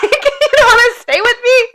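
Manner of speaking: high, loud whiny pretend hurt; mock hurt. Aunt pretending to be hurt by the toddler, high and whiny. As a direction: plead like a jilted aunt.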